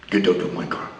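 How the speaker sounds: quiet low order; low quiet command. Retold or imitated. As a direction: growl it low and final. Mad Dog ordering him out.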